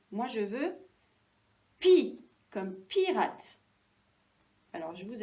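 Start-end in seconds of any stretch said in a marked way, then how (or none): 1.8-3.6 "pi, comme pirate" slow, pi louder; stress on pi. Shown as a demonstration. Teacher naming the wanted syllable, stress on pi. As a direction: punch both pi sounds in "pi, comme pirate". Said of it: "par la même syllabe que pirate".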